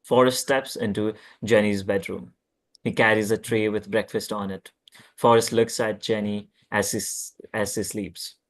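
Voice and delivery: ordinary talk, nothing marked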